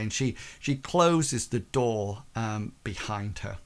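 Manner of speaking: ordinary talk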